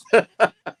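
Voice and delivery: sudden high loud laugh; a big laugh at her own complaint; burst of laughter. Real, not acted. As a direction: break into a real laugh. Laughs at the accent she can't stand.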